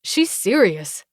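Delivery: plain reading voice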